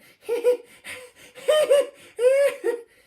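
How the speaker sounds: high, wild cackle of delight; wheezy triumphant laugh. Acted. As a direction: laugh till it hurts. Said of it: "a wheezy laugh of triumph and delight".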